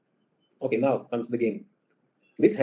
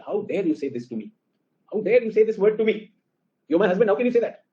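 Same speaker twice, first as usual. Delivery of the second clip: indignant, high-pitched retold scolding; angry wife; 8 semitones higher than usual. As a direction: act out an outraged wife telling off her husband. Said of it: "my wife is very angry with me".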